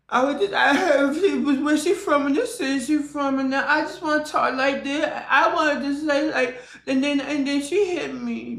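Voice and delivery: put-on high, babyish, innocent little voice; mock childlike innocence. Streamer mocking a girl who plays innocent after a fight. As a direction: put on a fake innocent kid voice. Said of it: "sound more innocent and sound more childlike".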